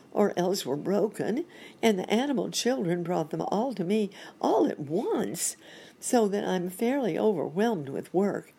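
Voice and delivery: high, animated storybook monkey; fretting monkey doctor. Acted. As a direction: explain the trouble as a harried monkey.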